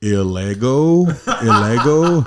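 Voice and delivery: "Illego" said slowly and questioningly; customer-service rep puzzling out the email name; mispronouncing a name. Retold or imitated. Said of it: "they're like".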